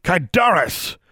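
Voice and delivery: high, forceful shouted incantation; lunging battle yell; yelled attack spell. A narrator's reading. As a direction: hurl the spell at your opponent.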